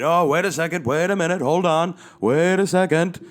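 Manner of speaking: high, loud, flustered babbling; flustered panic. Retold or imitated. Dad fumbling with remotes to hide the screen, high flustered pleading. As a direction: scramble to cover up, voice jumping up.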